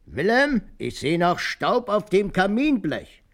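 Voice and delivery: sehr hoch und laut, langsam, nachgeahmt; Oma ruft. Retold or imitated. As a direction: keifend nachgemacht, Wort für Wort gedehnt. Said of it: "rief sie dann". (Opa ahmt Omas Vorwurf wegen des Staubs nach, hoch und laut)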